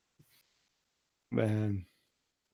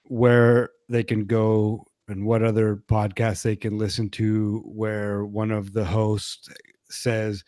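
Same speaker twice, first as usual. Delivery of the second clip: ordinary delivery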